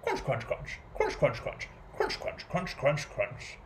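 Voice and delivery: rapid, crisp crunching in short bursts; munch out quick runs of crunches; crunching noise imitation. A narrator's reading. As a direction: squirrel munching a nut, in bursts. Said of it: "It sounded like".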